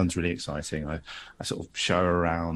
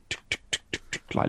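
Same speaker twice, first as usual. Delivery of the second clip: soft voiceless tuk tuk of little footsteps; footsteps imitation; 6 dB quieter, faster than usual. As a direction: tap it out quietly like small feet on stairs. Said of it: "little pitter patter of footsteps".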